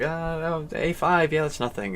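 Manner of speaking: high, careless dismissive tone. Retold or imitated. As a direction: brush it off like it's nothing. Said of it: "I was like".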